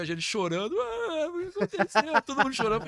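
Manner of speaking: high, loud mock crying wail; hammed-up crying. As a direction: wail through mock tears. Retells everyone crying.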